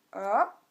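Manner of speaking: "A" sliding upward, loud; rising tone. Shown as a demonstration. Teacher models the second tone, "A" rising. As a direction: lift "A" steadily like a question. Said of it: "il monte, comme ça".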